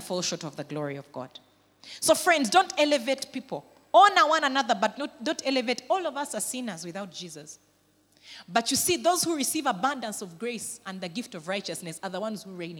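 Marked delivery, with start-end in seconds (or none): none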